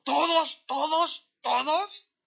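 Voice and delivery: high, loud disbelieving "¿Todos?" asked over and over; the hard man can't believe all sins are forgiven; incredulous pleading. Retold or imitated. Gunman asks the preacher, pleading in disbelief, high and loud.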